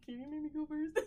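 sheepish laughing request; laughing plea. Retold or imitated. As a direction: timidly beg him to go ahead. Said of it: "And I was like".

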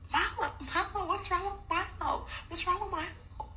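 put-on high, small worried child; soft high child calling Mama; little boy voice. Young son asks his mother about the dog, small high worried voice.

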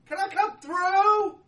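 a loud, high, drawn-out shout to the gatekeeper; calling out. Acted. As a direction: hail the guard from afar, high and loud. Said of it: "walks up to the gate and goes".

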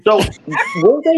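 talking while munching on chips. Real, not acted. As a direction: speak through a mouthful of chips. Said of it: "Zach is munching on chips right now".

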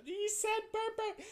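put-on high, shrieking drunk laughter; drunk laughing. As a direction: squeal it like a drunk laughing fit. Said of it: "I was just like".

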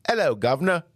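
high-pitched mock English accent. Shown as a demonstration. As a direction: put on a cockney-style English greeting. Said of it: "are not the same".

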